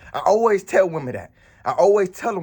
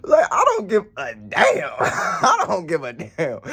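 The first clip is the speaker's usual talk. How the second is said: laughing; 12 semitones higher, slower than usual. Real, not acted. Laughing rant at her.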